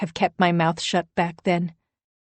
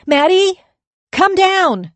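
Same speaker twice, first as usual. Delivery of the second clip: loud, much higher call up to a window; yell up to window; 12 semitones higher, 10 dB louder, slower than usual. A narrator's reading. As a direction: call up to her at full voice. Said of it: "I yell".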